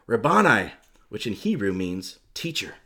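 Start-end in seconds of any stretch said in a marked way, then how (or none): 0.1-0.7 loud exclamation of recognition; narrated cry, voice raised; cried out. A narrator's reading. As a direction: call it out with force. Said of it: "She turned to him and cried out".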